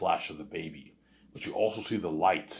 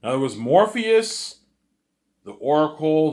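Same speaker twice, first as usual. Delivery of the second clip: excited; 9 semitones higher, 11 dB louder than usual. Real, not acted. Excited rant.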